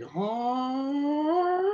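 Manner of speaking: high drawn-out mock "huh"; high mock call. Imitating women calling to passersby: high drawn-out "huh". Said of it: "they all go like".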